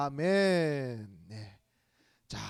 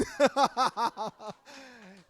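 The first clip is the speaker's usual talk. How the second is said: laughing; 6 semitones higher, 6 dB louder than usual. Real, not acted. Laughs at winner mixup.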